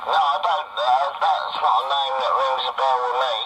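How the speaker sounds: ordinary delivery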